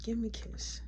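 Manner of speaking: seductive whisper. Retold or imitated. Kiki leaning on him at the lockers, whispering. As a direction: hush it close to his face.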